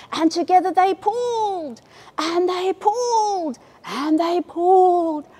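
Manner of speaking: high, slow, straining heave; slow effortful pulling chant. A narrator's reading. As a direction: heave each word like pulling hard.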